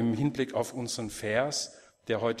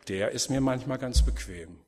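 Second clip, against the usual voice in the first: usual speaking voice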